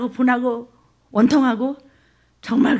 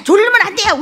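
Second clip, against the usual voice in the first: much higher and loud, keep him awake; urgent shout; 10 semitones higher, 11 dB louder than usual. Acted. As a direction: bark it to stop him drifting off. Rescuer keeping victim awake.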